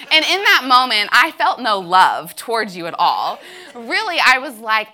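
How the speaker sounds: telling her friend she felt no love for him, loud. Real, not acted. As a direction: push it out loud for the crowd. Confessing anger to friend.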